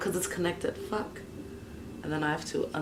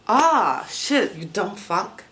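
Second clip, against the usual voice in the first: loud, high outburst then slower curse; scolding oneself; 4 semitones higher, 8 dB louder, slower than usual. Real, not acted. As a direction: burst out cursing at yourself. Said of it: "That was negative self-talk".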